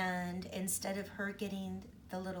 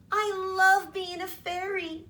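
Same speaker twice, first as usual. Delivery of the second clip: put-on high, loud delighted girl; little girl voice; 10 semitones higher, 10 dB louder than usual. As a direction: squeal it like a thrilled child. Girl character voiced in story.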